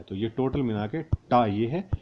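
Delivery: ordinary talk, nothing marked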